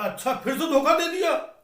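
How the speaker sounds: high, loud mock disbelief; loud mock astonishment; surprised. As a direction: gasp out as if shocked. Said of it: "बोला".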